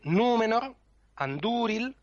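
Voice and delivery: "númenor" and "andúril" with the ú drawn out long; long accented vowel. Shown as a demonstration. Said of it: "si una vocal es larga". ("númenor" y "andúril" con la ú larga)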